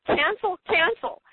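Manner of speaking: high, firm inner command; inner cancel. Retold or imitated. Carolyn cancelling the doctor's words, high and firm. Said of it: "she thought in her mind".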